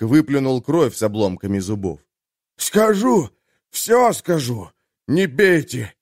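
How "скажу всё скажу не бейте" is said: high panicked pleading, gasping out each cry; desperate begging. A narrator's reading. Jailer begs the merchant to stop beating him, panicked. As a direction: beg for mercy under the blows, voice rising.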